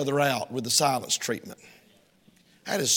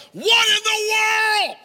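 high, loud shocked yell; mock alarmed outburst; startled shout; 8 dB louder, slower than usual. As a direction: shout it out like walking into chaos. Preacher acts startled shout at home.

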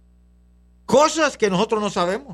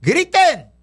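high, loud one-word order; commanding shout; 9 semitones higher, 5 dB louder than usual. Retold or imitated. Preacher retelling the order at Jericho, commanding shout. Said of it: "y le dijeron, gritaron".

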